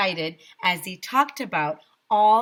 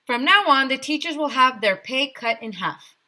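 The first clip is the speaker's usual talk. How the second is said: high, loud proclamation; mayor proclaiming pay cuts; loud decree; 7 semitones higher, 6 dB louder than usual. A narrator's reading. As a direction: boom it out. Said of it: "the mayor continued".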